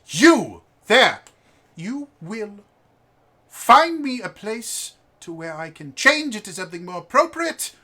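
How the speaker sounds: high, loud, commanding little aristocrat; imperious posh kid. Acted. Posh boy bossing the restaurant host, high and loud. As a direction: order the servant about like a lord.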